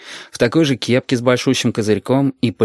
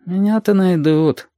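high, slow, absent-minded reply; 8 semitones higher, slower than usual. A narrator's reading. Yurik replies to the driver, absent-mindedly. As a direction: answer as if thinking of something else. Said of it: "откликнулся Юрик рассеянно".

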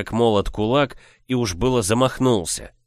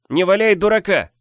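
pleading shout; 11 semitones higher, 6 dB louder than usual. Acted. Brother pleads loudly.

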